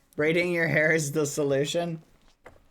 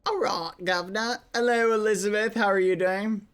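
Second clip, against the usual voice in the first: higher-pitched, lightly British greeting; British accent; 6 semitones higher than usual. Real, not acted. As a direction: greet them with a cheeky touch of British. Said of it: "Did you turn British for a second?".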